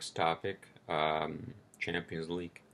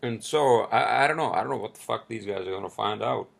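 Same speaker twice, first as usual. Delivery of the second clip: crunching chips; 4 semitones higher, 8 dB louder than usual. Real, not acted. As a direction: mouth full of chips. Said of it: "I'm sorry, I'm eating chips".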